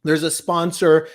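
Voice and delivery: usual speaking voice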